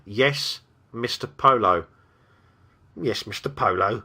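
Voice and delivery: mildly cowed servant creature; humble submission. A narrator's reading. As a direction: answer your master with humility. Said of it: "clouded with humility".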